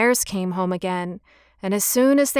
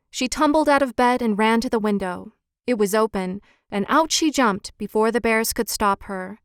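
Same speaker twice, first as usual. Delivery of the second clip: plain reading voice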